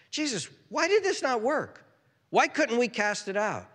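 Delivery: high, acted bewildered questioning; puzzled complaint. Disciples asking Jesus why it failed, baffled. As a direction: appeal upward in pitch, perplexed.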